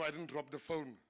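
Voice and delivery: usual speaking voice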